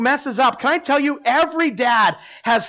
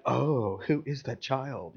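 hushed, lower, sniffy disdain; mock disapproval; 6 semitones lower, 9 dB quieter, slower than usual. Mocking the judgmental church people, hushed and sniffy. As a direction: imitate the pious onlookers looking down their noses. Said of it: "spiritual people are kinda like".

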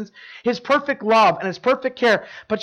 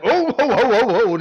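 higher, louder startled oh's; mock alarm; 4 semitones higher, 4 dB louder than usual. Musician alarmed as the kid grabs the instruments, mock fluster. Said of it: "I'm like".

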